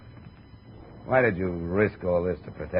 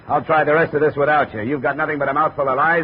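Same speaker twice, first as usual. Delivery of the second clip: higher, louder angry brush-off; sharp rising anger, near a shout; 7 semitones higher, 3 dB louder than usual. Acted. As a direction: push past him, furious. Angry confrontation with Al.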